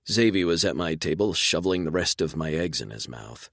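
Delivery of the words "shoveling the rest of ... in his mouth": plain reading voice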